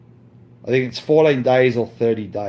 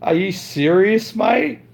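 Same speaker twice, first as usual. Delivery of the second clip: a higher, mock-disbelieving question rising at the end; incredulous; 7 semitones higher than usual. Retelling his reaction to the begging rival, mock disbelief. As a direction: act out his own disbelief when he heard it. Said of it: "I'm like".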